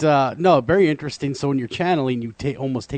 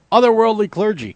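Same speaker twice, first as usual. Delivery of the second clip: laughing; 9 semitones higher, 7 dB louder than usual. Real, not acted. Laughing at own joke.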